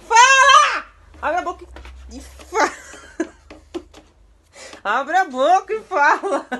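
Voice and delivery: high, loud yell, slow, with laughs; furious yell turning to laughter. Real, not acted. Mom yells at son, then laughs at his silence. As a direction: shout it, then crack up.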